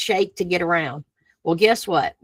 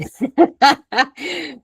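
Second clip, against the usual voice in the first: high-pitched giggling laugh; 6 semitones higher, 3 dB louder than usual. Real, not acted. Speaker on changing weather patterns, high laugh. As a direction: let out a high, delighted cackle.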